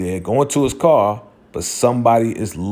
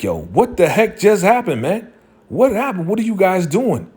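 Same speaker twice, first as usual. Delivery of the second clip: higher, exasperated what happened; exasperated disbelief; 8 semitones higher than usual. Retold or imitated. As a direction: throw up your hands at them. Tommy reacts after deal collapses.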